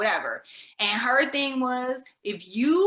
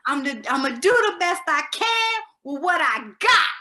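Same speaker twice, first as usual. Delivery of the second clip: tipsy, slurred diva line, louder and higher; 5 semitones higher, 5 dB louder than usual. Retold or imitated. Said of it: "in a very, kind of a slurred [name] voice".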